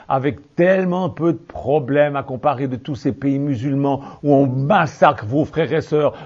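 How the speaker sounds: ordinary talk, nothing marked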